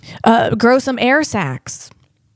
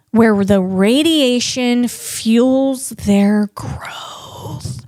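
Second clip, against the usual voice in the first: slow, hushed whisper; much slower than usual. Acted. Narrating to viewers about fungi, slow eerie whisper. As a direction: breathe the words out slowly like a secret. Spooky aside about radiation growth.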